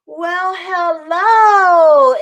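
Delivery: high, very drawn-out hello; delighted doctor's greeting. Retold or imitated. Doctor greeting the newborn, high lilting welcome. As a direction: sing out a welcome, slowly.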